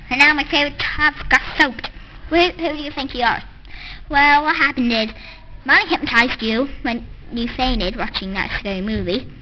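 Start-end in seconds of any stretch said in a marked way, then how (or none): none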